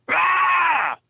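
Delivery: high-pitched mimicked "aah" yell; drawn-out, high mock scream; imitated Sasquatch yell. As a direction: imitate a creature yelling into someone's face. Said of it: "shoving it in my face, going".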